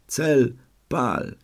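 high, sharp order to fire; shouted command. A narrator's reading. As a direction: bark the order to shoot. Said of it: "zawołałem".

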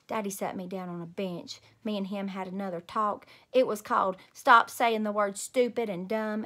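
ordinary delivery, nothing marked

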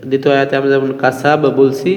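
normal talking voice

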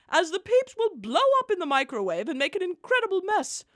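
usual speaking voice

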